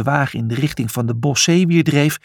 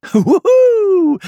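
high, loud gleeful whoop; wild joyful yell; 6 dB louder than usual. A narrator's reading. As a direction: let out a wild whoop of glee. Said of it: "riep Brutus lachend".

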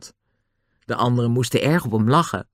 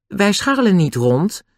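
polite, higher-pitched reply; 7 semitones higher than usual. A narrator's reading. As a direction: stay courteous, pitch lifted. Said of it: "zei Julian beleefd".

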